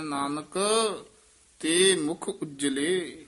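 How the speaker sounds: Nanak, a clear halt, then slow; pause after Nanak. Shown as a demonstration. Teacher demonstrating scripture reading, pause after Nanak. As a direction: stop after Nanak before going on. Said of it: "Nanak ਪਦ ਤੇ ਯਮਕ ਲਾ ਦੇਣੀ".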